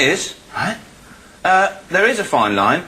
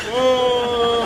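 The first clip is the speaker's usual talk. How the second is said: mock dim witness; 8 semitones higher than usual. Witness imitating fireball.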